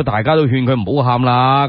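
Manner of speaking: ordinary talk, nothing marked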